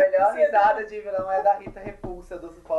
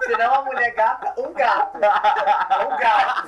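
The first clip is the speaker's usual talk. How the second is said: higher, louder, laughing; 5 semitones higher, 6 dB louder, slower than usual. Real, not acted. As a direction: giggle through the joke. Laughing joke about a hot man.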